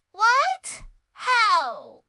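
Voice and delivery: high, loud startled cry; shocked gasp of disbelief; startled stammer. A narrator's reading. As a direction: blurt it out in shock. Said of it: "stammered Maya".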